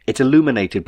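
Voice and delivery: ordinary delivery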